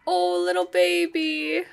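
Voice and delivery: sweet rising coo. Real, not acted. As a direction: coo at the kitten. Cooing at a kitten.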